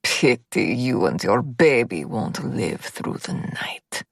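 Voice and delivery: put-on slow, low, cold Russian-accented threat. A narrator's reading. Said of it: "cold and cruel".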